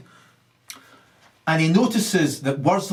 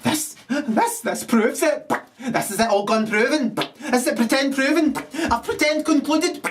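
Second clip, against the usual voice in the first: high gloating crow; 11 semitones higher than usual. Acted. Gloating triumph in squeaky voice.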